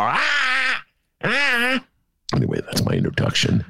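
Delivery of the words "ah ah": high, wild exclamations; manic psych-up cries. Retold or imitated. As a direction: yell like a madman warming up.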